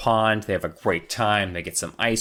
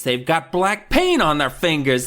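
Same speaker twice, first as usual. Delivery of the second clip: higher, louder mock-outraged reveal; dramatic realization; 6 semitones higher, 5 dB louder than usual. Joe realizing who painted the melons, dramatic reveal. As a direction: build to a big accusing reveal.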